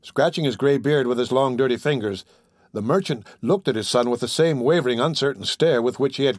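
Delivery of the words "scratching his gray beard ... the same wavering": ordinary delivery, nothing marked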